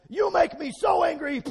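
loud, high hammed-up rage; mock angry God. Preacher imitating an angry God losing his temper, shouting. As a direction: rant like a fed-up, furious God. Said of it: "he doesn't go".

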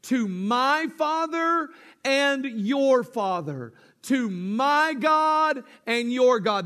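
solemn preacher's stress on Father and God; slow, weighty emphasis. Shown as a demonstration. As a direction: drop every word like a weight. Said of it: "listen to these words".

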